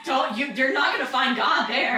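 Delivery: loud warning shout. Acted. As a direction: yell it as a warning.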